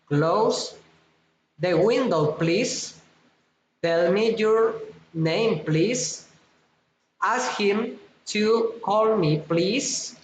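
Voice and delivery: "please" lifted at each end; rising requests. Shown as a demonstration. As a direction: request politely with "please" going up. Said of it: "rising intonation".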